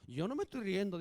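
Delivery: mock-innocent, suppressed giggle; stifled laughter. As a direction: playfully act out someone denying they are laughing. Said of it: "tu mujer se está riendo de nosotros".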